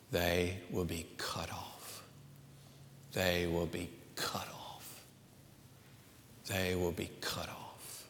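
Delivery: whispered, low and hushed; ominous whisper. Acted. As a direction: breathe the warning like a secret. Repeating scripture's warning, hushed.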